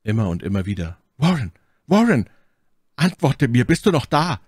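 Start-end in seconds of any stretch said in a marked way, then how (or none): 1.2-4.4 loud, high, desperate shouts; yelling. A narrator's reading. Narrator into the telephone to Warren, frantic yelling. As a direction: shout for an answer, pitch rising. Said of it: "brüllte und schrie".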